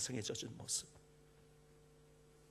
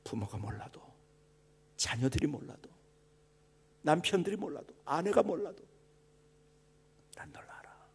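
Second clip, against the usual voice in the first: hushed preaching. Acted. Pastor assuring the congregation God knows them, in a hushed whisper. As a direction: lean in and breathe the words to the flock. Quiet intimate sermon opening.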